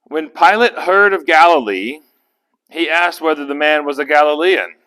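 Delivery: loud, declarative Bible reading; scripture reading. A narrator's reading. Pastor reading verse six to the group, proclaiming it. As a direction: read the verse out firmly to the room.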